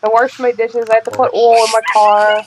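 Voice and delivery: Baltimore accent, "warsh" for wash. Shown as a demonstration. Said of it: "I have this Baltimore thing going".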